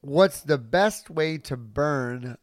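slow puzzled repeat. Real, not acted.

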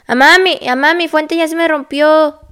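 loud, high urgent call to mom. Retold or imitated. Waking her mother at night, loud urgent call. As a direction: yell it to wake her up.

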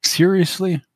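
sharp exasperated high Seriously. Acted. Kimmy seeing her comm buzz, exasperated. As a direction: roll your eyes at the caller. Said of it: "flashes into exasperation".

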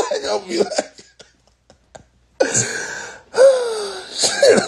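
laughing. Real, not acted. Laughing through imagined reaction.

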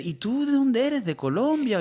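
high, slow questioning voice; curious coworker. Retold or imitated. Coworker asking if he's Colombian, high mimicked voice. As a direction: raise the pitch, drawing out the question. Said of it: "alguien me conoció y me dice".

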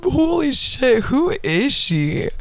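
high, slow, stunned exclamation; shocked. Real, not acted. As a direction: gasp it out in disbelief. Shocked reading her old posts.

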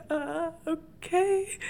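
high, slow, drawn-out unsure reply; hesitant, mock-bewildered. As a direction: imitate a baffled stranger backing off. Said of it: "she was so bewildered".

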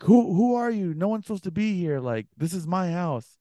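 high-pitched retold indignation; indignant challenge. Homeowner challenges the stranger behind the door, imitated indignation. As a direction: imitate a rattled homeowner protesting.

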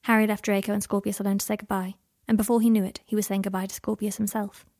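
usual speaking voice

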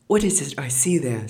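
high, loud gasp of disbelief; mother can't believe her eyes; astonished. A narrator's reading. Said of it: "quite astonished".

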